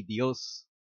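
ordinary delivery

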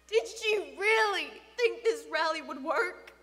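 loud, emphatic grieving questions; anguished bewilderment. Acted. Father questioning dead daughter's crusade, anguished. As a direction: demand an explanation out of grief.

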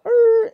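high, loud drawn-out tyre noise; imitated car screech. Storyteller trying to take off in the car, loud screech imitation.

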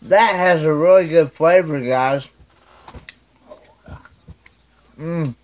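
food in mouth; eating between words; chewing while talking. Real, not acted. Seen 1: Bites bacon at -12; jaw working, bacon at mouth throughout.

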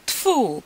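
high-pitched contemptuous spit sound; dismissive spit of disgust; disgusted spitting. A narrator's reading. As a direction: spit with scorn. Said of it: "powtórzył".

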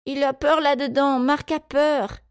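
put-on high, whiny little girl; high-pitched snivelling protest; whimpering. A narrator's reading. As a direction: plead on another child's behalf, close to tears. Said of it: "Ella se mit à pleurnicher".

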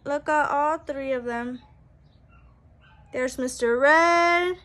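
usual speaking voice

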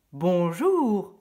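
loud, falsely friendly hello; sly friendly greeting. Acted. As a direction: greet her with a fake smile to lull her. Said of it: "lança-t-il en souriant pour ne pas l'effrayer". (bonjour lancé avec un sourire trompeur)

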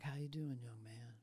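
soft, low-energy murmur, fading to a whisper; quiet, tired greeting to a young man. Retold or imitated. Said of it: "just that kinda low energy".

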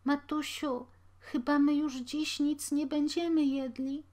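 scared little voice; fearful sick child. A narrator's reading. Sick child to his mother, high and timid.

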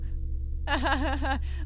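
put-on, rattling "ha ha ha"; mock laugh. Junior colleague about to read the poem, mock laugh. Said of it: "Laughing, my colleague picked up".

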